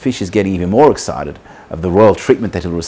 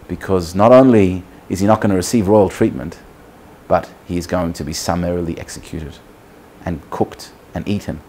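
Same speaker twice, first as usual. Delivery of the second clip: ordinary delivery, nothing marked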